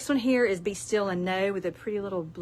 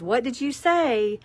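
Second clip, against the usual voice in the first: Southern woman asking; 3 semitones higher, 5 dB louder, slower than usual. Retold or imitated. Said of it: "If a Southern woman says".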